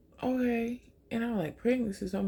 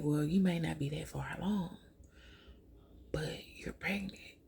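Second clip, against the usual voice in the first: whispered you're pregnant; quiet confirmation; 7 dB quieter than usual. Retold or imitated. Nurse softly confirming the pregnancy to her patient, whispered.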